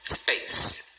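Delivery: "space" in a single beat; "space" as one syllable ending in a long S. Shown as a demonstration. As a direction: say "space" with no vowel before or after. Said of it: "também uma sílaba".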